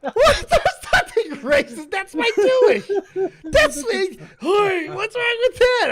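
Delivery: high, laughing, shouting denial; laughing protest. Real, not acted. Defending the joke, laughing.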